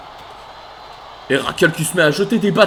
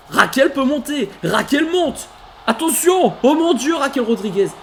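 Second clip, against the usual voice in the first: building excitement; 6 semitones higher than usual. Real, not acted. Raquel climbs the ladder.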